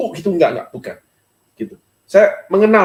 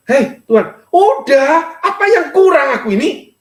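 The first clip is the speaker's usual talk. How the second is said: retold angry complaint; 6 semitones higher than usual. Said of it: "marah saya ini".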